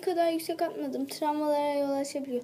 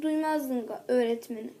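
usual speaking voice

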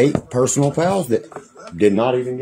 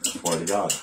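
usual speaking voice

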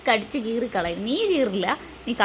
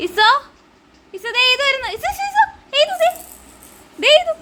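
high sing-out call; excited calling out; 12 semitones higher, 4 dB louder than usual. Real, not acted. Mother calling Iso to see who is coming, high excited calling. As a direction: call out high and eagerly to get attention.

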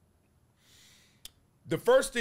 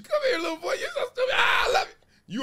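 much higher, loud, teasing squeal; hammed-up creepy playful stranger; father acting a stranger grabbing his son, shrill and loud; mock predator; 6 dB louder than usual. Father plays a grabber teasing the boy.

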